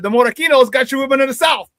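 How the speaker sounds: high, loud re-enacted jab; heated comeback. Retold ball-busting comeback to teammates.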